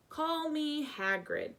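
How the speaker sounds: lightly voiced friendly giant; Hagrid. A narrator's reading.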